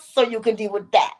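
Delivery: ordinary talk, nothing marked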